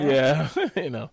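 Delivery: slow words through a laugh; chuckling while answering. Real, not acted. Laughing at the joke.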